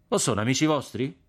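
a touch of sneer behind a smile; scornful question. A narrator's reading. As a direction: needle him with the question. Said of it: "con un sorriso di scherno".

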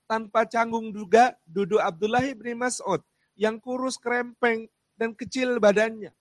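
preaching with rising fervour, loud and high; rousing sermon. Acted. Preacher stirring the congregation with the companions' story. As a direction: build it up like a preacher painting the scene.

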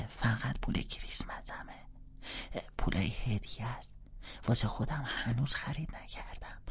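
little sister offering, hushed; whisper. Acted.

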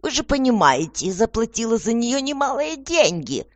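high, insistent complaint; demanding. A narrator's reading. Lady who paid money for her, demanding. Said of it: "требовательно говорила её светлость".

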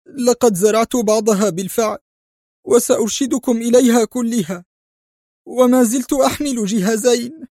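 high, tearful answering voice; crying. Acted. Spy telling the director where the bugs are, crying. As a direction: weep while you confess. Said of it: "عاد يبكي مجيبا".

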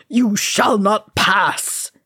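slow, loud roar of defiance. A narrator's reading. Bloodied wizard barring the bridge, roaring. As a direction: roar each word out, barring the way. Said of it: "he roared".

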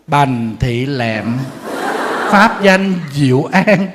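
"Bành Thị Lẹm" read very slowly, each syllable drawn out; đọc chậm rãi, rõ từng chữ; slow clear name reading. Shown as a demonstration. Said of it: "phải đọc cho rõ tên".

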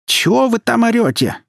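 very high, loud startled call; flustered mother, voice shot up; alarmed. A narrator's reading. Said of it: "всполошилась мать".

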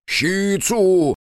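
loud call of the name. A narrator's reading. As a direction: call to reach him. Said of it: "пытался дозваться Соба".

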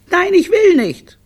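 put-on very high, loud little-kid refusal; stubborn small child. Littlest refusing to greet the director, high and stubborn. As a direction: squeal it like a defiant toddler.

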